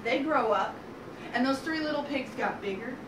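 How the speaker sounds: plain reading voice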